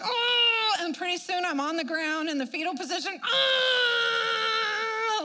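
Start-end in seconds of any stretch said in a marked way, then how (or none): 0.0-0.8 high, loud wailing groan; retold groaning cry. On the floor in intercession, a loud wailing groan. 3.2-5.2 high, loud wailing "ah"; retold wail. Lying in the fetal position, a loud wailing cry. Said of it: "like this".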